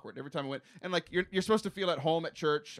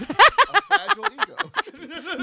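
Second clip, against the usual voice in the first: laughing mock repeat; 14 semitones higher, 10 dB louder, much slower than usual. Teasing repeat of host's phrase.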